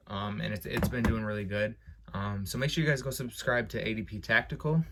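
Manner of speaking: ordinary delivery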